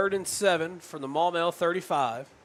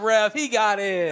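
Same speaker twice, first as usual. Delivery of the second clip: pleading with the referee; 5 semitones higher, 7 dB louder than usual. Real, not acted. Arguing the spot with the ref.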